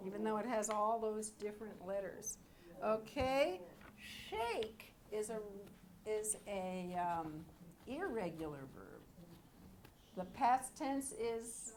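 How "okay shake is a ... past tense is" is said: ordinary delivery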